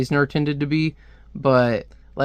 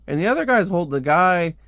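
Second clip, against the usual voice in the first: ordinary talk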